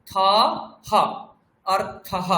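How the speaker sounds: থ and হ apart, then joined as অর্থহ "artha-ha"; syllable breakdown. Shown as a demonstration. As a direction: isolate হ, then put it on the end of অর্থহ. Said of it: "পরে একটা বিসর্গ আছে". (ভেঙে থ, হ, তারপর অর্থহ)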